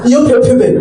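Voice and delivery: normal talking voice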